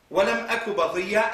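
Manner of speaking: rapid chanted Arabic recitation; Quranic recitation. Acted. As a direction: recite the verse quickly.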